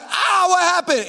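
high, loud, drawn-out alarm; panicked cry. Retold or imitated. Reenacts panic at injured officer.

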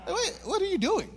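high, softish puppy voice; imitating a confused puppy; puzzled puppy. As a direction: voice a baffled little dog, head tilted. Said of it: "look at me like".

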